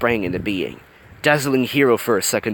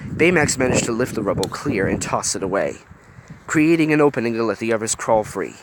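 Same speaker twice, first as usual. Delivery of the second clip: plain reading voice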